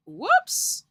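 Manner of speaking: sudden high yelp of surprise; surprised whoops. Real, not acted. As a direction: yelp as something slips. Setup suddenly goes wrong.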